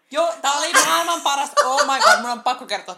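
loud, high, laughing excitement; real gleeful exclamation; excited outburst. Real, not acted. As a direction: burst in loudly, thrilled and starting to laugh. Bursts in with favourite story.

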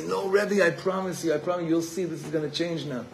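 a guilty student swearing he'll change; pleading student. Retold or imitated.

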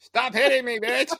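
high, laughing clown yelp; silly clown. Pretend. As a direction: squeal like a clown getting hit. Playing the windmill clown being hit.

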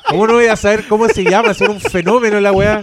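loud, fast laughing outburst; excited laughing. Real, not acted. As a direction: blurt it out laughing. Laughing exclamation. (exclamación riendo)

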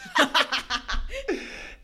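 high, loud burst of laughter; laughing out loud. Real, not acted. Guest joking about sounding humble, loud laugh. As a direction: laugh hard and high at your own joke.

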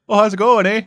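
put-on Canadian accent. Greeting someone who comes to town, in a mock Canadian accent. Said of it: "there's no Canadians just yet".

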